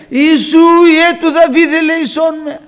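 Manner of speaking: loud, high, sustained cry for mercy; high shouted plea to someone passing; insistent shout. Acted. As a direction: keep calling out at full voice. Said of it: "Πιο δυνατά αυτός φώναζε".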